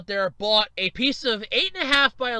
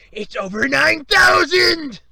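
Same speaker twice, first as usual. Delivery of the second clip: high, loud, drawn-out yell; meme shout; 7 semitones higher, 10 dB louder, slower than usual. Acted. Host hyping the launch with a meme, hammed-up shout. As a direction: bellow it as an over-the-top catchphrase.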